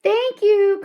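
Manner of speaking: high, loud call across the sand; girl calling thanks to the departing cat. A narrator's reading. Call it after the cat. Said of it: "called Annie".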